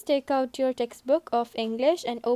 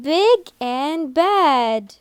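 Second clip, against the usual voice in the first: stretched out slowly, word by word; teacher drilling the answer slowly; slow model answer; 2 semitones higher, 5 dB louder, much slower than usual. Shown as a demonstration. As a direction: spell it out slowly for the class. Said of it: "repeat after the teacher".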